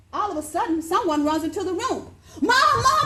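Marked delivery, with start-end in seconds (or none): 2.4-3.1 put-on high, loud panicked cry of a girl; hammed-up kid yelling for mom; frantic yelling daughter. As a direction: shout like the house is burning. Daughter wakes mom about fire.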